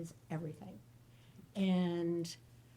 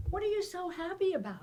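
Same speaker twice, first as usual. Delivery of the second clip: puzzled shrink; 11 semitones higher, faster than usual. Acted. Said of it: "he's like".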